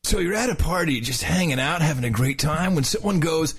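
surfer dude narrating; laid-back surfer announcer. Shown as a demonstration. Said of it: "the whole thing in a surfer's voice".

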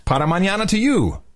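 high, sing-song fake Spanish; mock Spanish again. Wishing co-host well in mock Spanish. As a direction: toss off a playful Spanish sign-off. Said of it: "Yes, more of that".

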